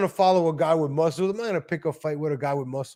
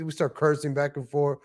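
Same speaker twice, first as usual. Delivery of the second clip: ordinary talk, nothing marked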